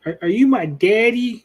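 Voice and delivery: hammed-up child asking for her dad; childish Leia. Mocking Leia asking Obi-Wan if he is her father, high and childish. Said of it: "She's like".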